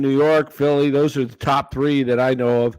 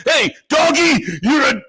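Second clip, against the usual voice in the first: high, loud, mocking radio host; Francesca impression; 11 semitones higher, 3 dB louder than usual. Co-host shouting back at Mad Dog on air. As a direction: shout back at Doggy, teasing. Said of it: "And Francesca would be like".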